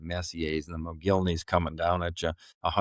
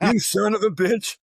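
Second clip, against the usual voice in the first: retold yell; 6 dB louder than usual. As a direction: yell it at the machine like a fight. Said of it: "he's yelling at the treadmill".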